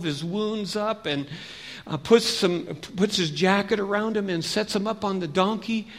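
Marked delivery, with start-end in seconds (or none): none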